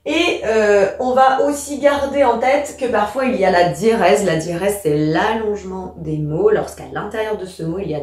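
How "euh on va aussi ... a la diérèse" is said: ordinary delivery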